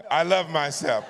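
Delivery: loud, high rallying declaration; big shouted affirmation; leading a group affirmation. Shown as a demonstration. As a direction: lead the crowd in it at full voice. Leads the audience saying it together.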